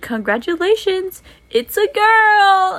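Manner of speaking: high, slow, loud cheerful announcement; vet's office announces a girl, beaming; announcer cheer. Retold or imitated. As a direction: sing it out like big news. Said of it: "they were like".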